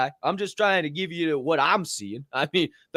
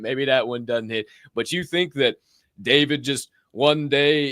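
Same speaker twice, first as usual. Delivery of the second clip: ordinary talk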